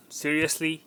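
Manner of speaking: usual speaking voice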